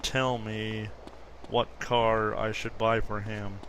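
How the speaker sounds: ordinary delivery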